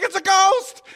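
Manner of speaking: high, startled yelp of fright; terrified cry. Retold or imitated. Disciples seeing Jesus on the water, terrified. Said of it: "And they were terrified".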